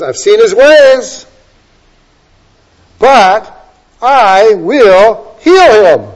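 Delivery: loud, high, very slow, with I and HEAL stretched out; emphatic voice of God. Acted. Preacher quotes God promising to heal, loud drawn-out proclamation. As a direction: proclaim it, weighting "I will heal". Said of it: "the emphasis on the word "I".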